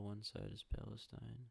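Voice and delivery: ordinary talk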